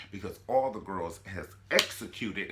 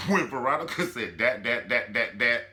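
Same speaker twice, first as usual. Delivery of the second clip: laughing stammer; 7 dB louder than usual. Real, not acted.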